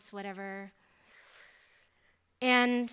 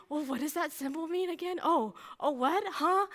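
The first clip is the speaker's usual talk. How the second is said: high-pitched mock bewilderment; flustered confusion; 6 semitones higher than usual. Student flustered by top student seeing her flashcards, acted bewilderment. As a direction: act caught out and lost, voice jumping up. Acting flustered reaction to being corrected.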